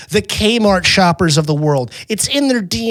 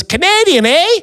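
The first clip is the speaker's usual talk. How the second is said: high, hammed-up Canadian accent; put-on Canadian accent; 13 semitones higher, 3 dB louder than usual. Diner passing himself off to a tourist, fake Canadian accent. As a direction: lay a Canadian accent on thick. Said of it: "I did my best maple syrup".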